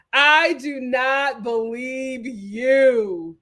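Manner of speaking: very slow, every word punched; emphatic disbelief. Real, not acted. Vlogger calling out Victoria, slow and punched. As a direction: spell it out word by word.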